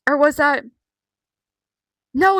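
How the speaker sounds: ordinary delivery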